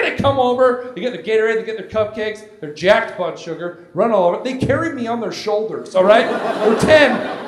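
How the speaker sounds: usual speaking voice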